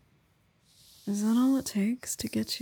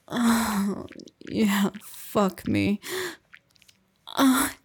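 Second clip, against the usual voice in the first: loud moaning; 5 dB louder than usual. Acted. Moaning in climax.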